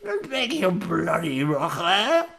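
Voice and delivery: put-on British accent. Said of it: "British accent".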